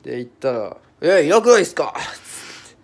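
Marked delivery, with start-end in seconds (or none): 1.0-2.0 higher, louder accusing Kansai-dialect question; annoyed Kansai customer, loud and high; Kansai-accented customer. Retold or imitated. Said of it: "なんか大阪弁だったっすね".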